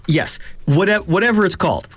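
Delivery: high, exasperated giving up; exasperated high outburst. Real, not acted. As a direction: toss it off, annoyed at yourself. Frustrated, can't remember word.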